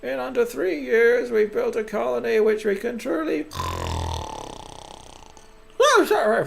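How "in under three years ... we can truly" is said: high, ceremonial speech voice; pompous council head. Acted. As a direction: address the colony in a high, grand voice.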